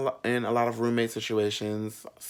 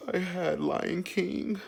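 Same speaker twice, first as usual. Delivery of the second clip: high, slow whiny complaint; mock the upset Disney fan in a high voice; prissy high-pitched tattletale voice; mimicking the roommate; 8 semitones higher, slower than usual. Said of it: "He's like".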